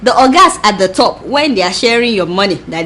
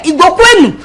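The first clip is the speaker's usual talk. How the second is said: rallying call; 7 semitones higher, 4 dB louder than usual. Acted. Rallying call to Igbo audience.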